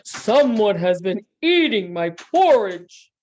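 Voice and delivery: loud, booming bear cry; huge storybook bear bellow; Papa Bear's great big voice. Acted. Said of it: "Papa Bear cried out in his big, great big voice".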